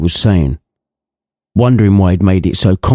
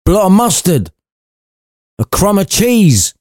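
high, fussy old miser mocking; Scrooge's high voice; 9 semitones higher than usual. Scrooge sneering at the ghost, high miserly voice. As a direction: pitch it up as a scornful old Scrooge.

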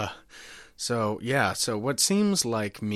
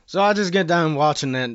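excited; 7 semitones higher, 8 dB louder than usual. Acted. Excited prank caller.

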